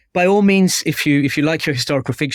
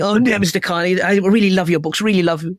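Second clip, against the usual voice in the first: gushing, higher-pitched attempt at an American accent; bad American accent; 6 semitones higher than usual. Author quoting a Californian reader's letter, in a bad American accent. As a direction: put on a poor American accent. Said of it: "that's not a very American accent".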